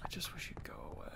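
fast, breathless whisper; hushed fed-up whisper; whispered aside. Real, not acted. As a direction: whisper it under your breath. Muttering at annoying customer.

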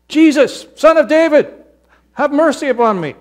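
high, loud desperate call; shouting. Acted. Blind beggar calling out to passing Jesus, shouting. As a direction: cry out over the crowd. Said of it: "he started to shout".

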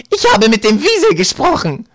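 loud, high-pitched grating crone; rasping old woman. A narrator's reading. Old woman correcting Eddie about her weasel, rasping screech. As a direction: rasp it out loudly, high and indignant. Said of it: "raspelte die Frau".

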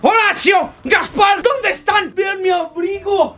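haughty villain calling out. Acted. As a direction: bark for the henchmen. Cruella calling her henchmen.

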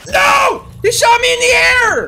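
high, loud outraged cry; dismayed shout. Real, not acted. Gamer yelling after being shot down in the air. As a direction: yell in disbelief at getting shot.

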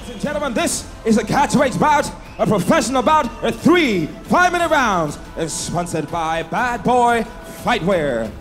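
ordinary delivery

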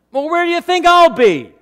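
high, loud incredulous retort; stunned comeback. Retold or imitated. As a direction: fire back in disbelief. Pastor's stunned comeback in story.

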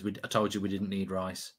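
ordinary talk, nothing marked

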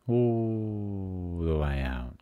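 long, gliding "all" that slowly descends; drawn-out guidance. Real, not acted. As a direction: let "all" stretch out slowly, as if travelling far. Guiding attention outward from the body.

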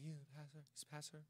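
very soft, low, reluctant admission; embarrassed mumble. Retold or imitated. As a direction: imitate confessing it almost inaudibly, ashamed. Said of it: "I was embarrassed to say what my dad did".

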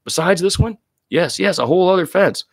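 high, loud mock cry of dismay; hammed-up high-pitched complaint; exasperated cry. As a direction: squeal it out like a frazzled hiker. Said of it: "a cry goes up".

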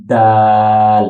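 loud দাল, tongue on upper tooth roots, long aa; pronunciation demo. Shown as a demonstration. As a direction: press out the d of দাল, then hold the vowel. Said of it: "উপরের দাঁতের গোড়ার সাথে".

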